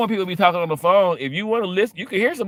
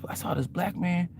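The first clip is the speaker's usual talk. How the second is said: normal talking voice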